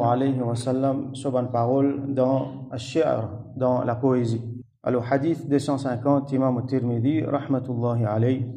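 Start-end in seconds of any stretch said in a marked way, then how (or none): none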